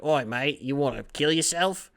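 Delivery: a light Australian twang, teasing; jokey Australian accent, not too broad; mock Australian Ben Simmons. Ben Simmons greeting Jimmy Butler, in a mock Australian accent. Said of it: "Ben's gonna be like".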